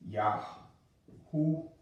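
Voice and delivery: Yahuhanan said slowly, with a clear h sound in the middle; careful Yahuhanan. Shown as a demonstration. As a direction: spell out Yahuhanan slowly, h not k. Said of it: "it's actually Yahuhanan".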